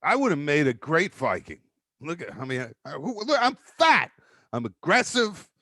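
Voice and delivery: normal talking voice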